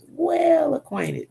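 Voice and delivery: Well acquainted sung out high and slow, both words stretched; drawn-out emphasis. Real, not acted. Preacher repeating it to the congregation, high and slow. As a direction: lean hard on Well acquainted, slow and lifted.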